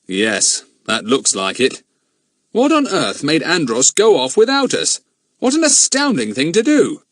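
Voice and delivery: a lightly voiced grown man, grave; steady adult man puzzling it out; a hint of a serious man; Bill's voice. Bill grim, astounded.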